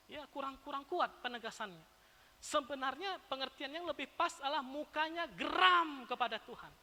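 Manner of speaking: ordinary talk, nothing marked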